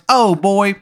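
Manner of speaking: high, loud, badly faked Maryland voice; terrible Maryland accent. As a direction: ham up a cartoonish Maryland accent. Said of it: "sounds like a bad impression of a Maryland accent".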